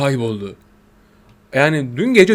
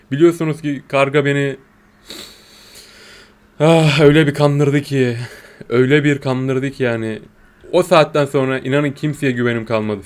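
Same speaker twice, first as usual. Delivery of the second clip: ordinary talk, nothing marked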